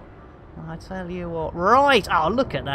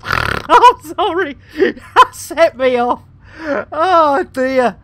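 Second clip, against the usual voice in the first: slow, high, loud words through real laughter; can barely get the words out for laughing; laughing apology; 7 semitones higher, 7 dB louder, slower than usual. Real, not acted. Streamer apologising to chat while still in fits of laughter.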